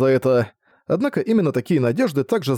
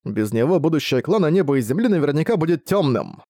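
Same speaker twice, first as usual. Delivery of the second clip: ordinary talk, nothing marked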